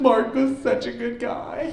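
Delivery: high, slow, strained fake weeping; hammed-up funeral sob while holding in smoke; mock crying. Mourner sneaking a vape at a funeral, mock tearful squeak. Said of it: "Right, they're crying".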